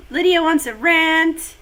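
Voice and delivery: usual speaking voice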